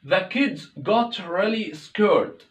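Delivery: slow, clear English sentence read for learners; teacher reading a slow example sentence; slow careful example. Shown as a demonstration. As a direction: read it out slowly, word by word. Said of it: "example read slowly".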